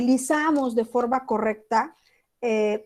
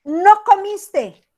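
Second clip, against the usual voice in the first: high, drawn-out emphatic "No comiste"; demo of a typed message; 7 semitones higher, 8 dB louder, much slower than usual. Shown as a demonstration. Teacher demos a text message to students, emphatic. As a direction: read "No comiste" high and slow, leaning on "No". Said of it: "cuando nosotros ponemos".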